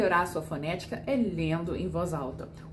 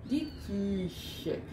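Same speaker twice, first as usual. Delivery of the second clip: Die Küche repeated much softer; 7 dB quieter than usual. Shown as a demonstration. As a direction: echo Die Küche quietly. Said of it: "a gente abre a boca".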